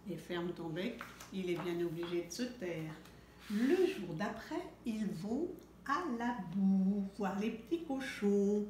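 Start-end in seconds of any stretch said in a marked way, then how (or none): none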